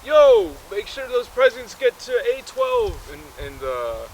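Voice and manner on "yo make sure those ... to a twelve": very high, loud order; piercing falsetto shout; bossy lady. Acted. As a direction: holler instructions across the workshop as a lady. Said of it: "some lady's like".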